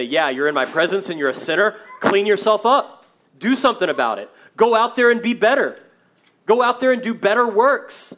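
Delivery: loud, high, hammering mock scolding; harsh rebuke. Imagined God scolding sinful Isaiah in his presence, harsh shouted rebuke. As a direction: shout it like an unforgiving taskmaster.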